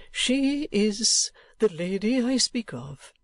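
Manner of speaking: name her slowly and carefully; kindly old gentleman, deliberate. Acted. Old gentleman telling Nicholas who she is, deliberate.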